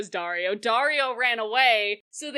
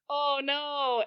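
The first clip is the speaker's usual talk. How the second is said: higher mock dismay; fake dismay; 5 semitones higher than usual. [name] backs up the faked death, mock dismay. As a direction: play along with fake alarm.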